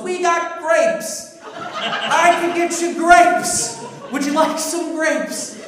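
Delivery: high, loud, slow pleading offer through laughter; eager offer. Retold or imitated. Uncle desperately offering his vegetarian guest grapes, high and loud. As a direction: overeager host stretching out each word.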